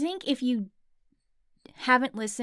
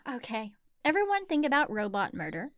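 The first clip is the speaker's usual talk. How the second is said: ordinary talk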